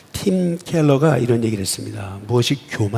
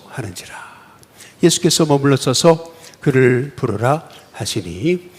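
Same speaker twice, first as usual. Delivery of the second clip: ordinary talk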